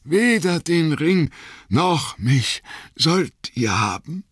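defiant last stand. Acted. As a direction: refuse them with failing strength. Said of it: "rief Frodo mit letzter Kraft".

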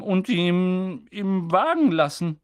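slowly suggested. A narrator's reading. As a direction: draw the words out. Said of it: "sagte Tante Petunia langsam".

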